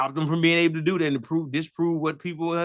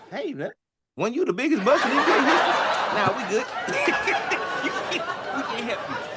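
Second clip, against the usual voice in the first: higher, laughing as the owners; laughing mock rejection; 9 semitones higher than usual. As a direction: chuckle through the brush-off. League owners turning him down.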